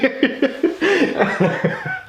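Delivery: very high fit of laughter; real squeaky, uncontrolled laughing; helpless laughter. Real, not acted. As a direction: lose it at the joke. Laughs at cats joke.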